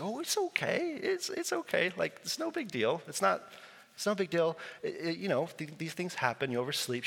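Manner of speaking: lightly mocking soothing voice as he waves it off; high gentle it's-okay voice; reassuring pastor. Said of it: "She's like".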